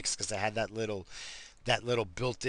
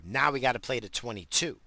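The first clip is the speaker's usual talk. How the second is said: usual speaking voice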